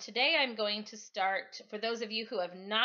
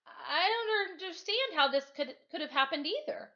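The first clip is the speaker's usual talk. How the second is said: high, loud, indignant woman's voice; indignant Mrs V; stern teacher; 10 semitones higher, 5 dB louder than usual. Acted. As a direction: voice that could crush bricks. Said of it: "Her voice could crush bricks".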